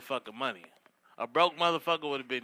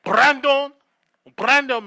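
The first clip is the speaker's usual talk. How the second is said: rolled r, high and loud Spanish "Brandon"; 10 semitones higher, 9 dB louder, slower than usual. Retold or imitated. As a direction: roll the r in "Brandon" with a Spanish accent. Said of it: "that's how they put that R on it".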